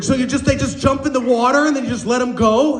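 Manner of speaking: high, wide-eyed questioning; naive amazement. Acted. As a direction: play the astonished, gullible listener. Acting amazed woman asking trainer.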